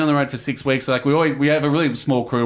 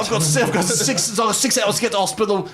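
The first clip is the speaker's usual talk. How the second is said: imitating the Venom Hunter; 8 semitones higher than usual. Telling cohost about the ex-soldier, hammed-up tough-guy impression. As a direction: ham up a stoic commando gritting through pain. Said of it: "grits through it and goes".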